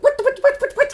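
very high, stuttering disbelief; mock flustered sputter. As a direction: sputter like someone caught off guard. Acts husband's flustered reply.

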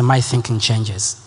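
low, slow, prayerful repetition; hushed worship leader, unhurried. Acted.

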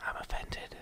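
very soft, whispered fake hurt; hushed pretend offence; mock-offended whisper. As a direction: whisper it as if wounded, jokingly. Joking whisper after beaner jab.

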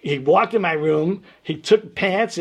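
usual speaking voice

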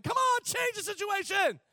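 high, pleading voice as Paul. Retold or imitated. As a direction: beg God as Paul, pitched high. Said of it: "I can see the Apostle Paul saying".

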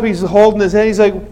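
normal talking voice

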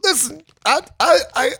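high teary break from the spice; crying. Real, not acted. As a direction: voice cracking into tears from the heat. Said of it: "Are you crying?".

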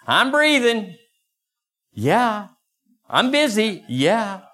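high, sing-song excuses; mock excuses. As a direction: mimic lame excuses. Mocking excuses.

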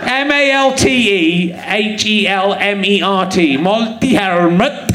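slow, drawn-out sounding out; incredulous spelling out. Real, not acted. Comedian reading a dubious name to the audience, slowly sounding out. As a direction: linger over each letter, then the name.

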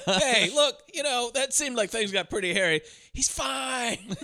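high, laughing mock comfort; mock reassuring. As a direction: brush it off in a high squeaky voice. Mocking movie ending, high voice.

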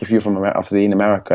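ordinary delivery

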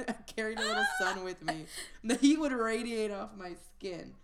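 laughing. Real, not acted. Joking boast, laughing.

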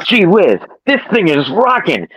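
put-on high and loud thrilled teen; excited. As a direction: shout it out amazed. Marty excited in time machine.

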